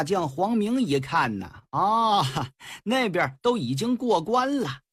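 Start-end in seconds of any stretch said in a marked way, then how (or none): none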